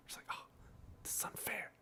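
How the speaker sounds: tiny whispered aside of complaint. Acted. Player reacting to enemies shooting the squad, whispered. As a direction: whisper it, barely any voice. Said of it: "I'm whispering so much tonight".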